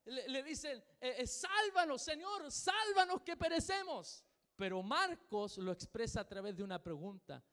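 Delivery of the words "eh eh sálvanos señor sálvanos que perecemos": much higher, louder panicked cry; frantic plea. Acted. Pastor playing the terrified disciples in the boat, panicked. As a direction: beg like you're sinking. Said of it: "vueltos locos, corriendo para allá".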